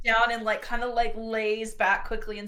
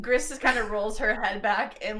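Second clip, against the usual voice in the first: ordinary talk, nothing marked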